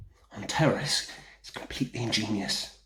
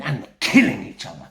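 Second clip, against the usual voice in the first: ordinary talk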